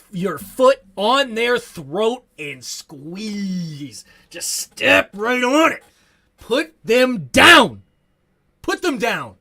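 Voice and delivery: slow, loud growling intensity; rising to a fierce push; Doc Walker quote. Retold or imitated. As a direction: lean in hard like a fired-up coach. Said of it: "As Doc Walker loves to say".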